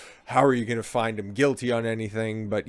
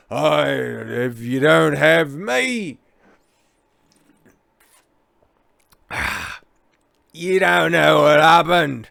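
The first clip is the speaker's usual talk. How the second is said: put-on very slow, louder drunk English slur; drunk English accent; 5 semitones higher, 8 dB louder, much slower than usual. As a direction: drag the words like a drunk Englishman. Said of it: "grab bag of English accents".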